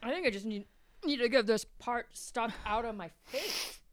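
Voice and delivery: talking around a burning mouthful; mouth full of hot food. Real, not acted. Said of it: "I literally have to suck up my spit".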